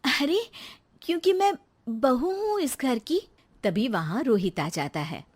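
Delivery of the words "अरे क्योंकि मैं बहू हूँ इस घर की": put-on high-pitched young wife, indignant; indignant daughter-in-law. Bride defending her place to mother-in-law, high and indignant. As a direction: claim your place, voice up high. Narrator voices Rekha.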